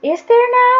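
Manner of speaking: light up with surprise at the news; amazed. A narrator's reading. Joseph amazed at the river.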